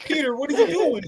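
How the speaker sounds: high, stunned Miles voice, laughing at the edges; shocked Miles. Pretend. Teen hero, stunned by his mentor's words, high and incredulous. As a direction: gasp it out as a rattled teen hero.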